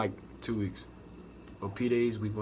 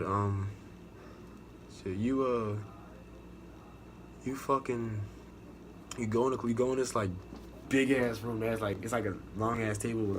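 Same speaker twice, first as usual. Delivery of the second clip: ordinary talk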